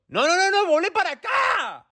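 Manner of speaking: high-pitched urgent yell; alarmed shout. Acted. Calling back someone running off, alarmed shout. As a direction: call them back in a panic. Calls after someone taking something.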